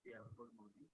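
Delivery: faint, distant voice on the call; too quiet to hear clearly; barely audible. Real, not acted. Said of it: "your voice is very low".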